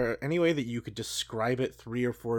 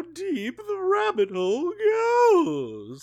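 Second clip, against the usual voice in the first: dramatic Morpheus voice; 10 semitones higher, 7 dB louder than usual. Acted. Ellimist offers the choice dramatically.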